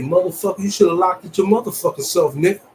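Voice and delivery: loud, high, furious clapback; a hammed-up roommate blowup over the door; angry comeback. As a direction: bark it back at him like he insulted you. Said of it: "I'ma say".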